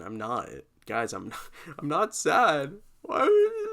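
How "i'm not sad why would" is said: hammed-up tearful break; mock crying. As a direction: voice cracking up into a high wail, louder. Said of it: "I started crying when I was saying I'm not sad".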